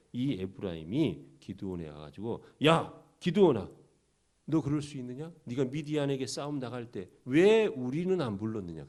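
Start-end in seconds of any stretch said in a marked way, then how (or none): 2.7-8.9 louder, raised-pitch indignant challenge; angry confrontation. Acted. Ephraim confronting Gideon for not calling them to battle, angry. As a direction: call him out, punching the opening words hard. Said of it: "기드온에게 막 화를 내는 거예요".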